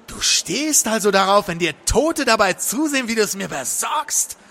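high, indignant accusation; disgusted, angry woman. A narrator's reading. Woman accusing her client, disgusted outrage. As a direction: throw it at him, outraged.

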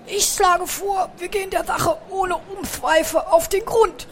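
high, lisping hamster hero voice; Bertram, bold call. A narrator's reading. Hamster drawing his sword to propose investigating, high bold call. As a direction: declare it grandly, drawn out at first. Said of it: "rief Bertram und zückte sein Degen".

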